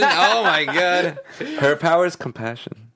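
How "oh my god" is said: slow amused laugh; giggling through it. Real, not acted. Laughing at the idea.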